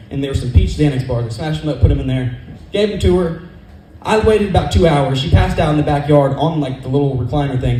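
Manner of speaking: usual speaking voice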